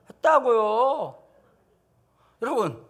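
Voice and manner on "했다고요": very slow, higher, louder dragged whine; sulky child, louder. Pretend. Seven-year-old answering the teacher again, louder whine. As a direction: whine it louder and slower, defiant.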